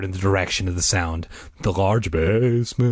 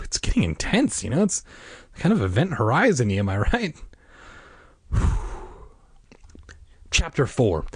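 ordinary delivery, nothing marked

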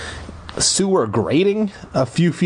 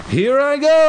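high, loud hammed-up bravado; excited; 5 dB louder than usual. A narrator's reading. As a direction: launch it like a brave leap. Bracing to climb down.

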